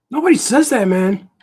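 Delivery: high, loud mock heckler; loud high-pitched objection; objecting heckler. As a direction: butt in with a put-on high voice. Imagined heckler objects to the accent.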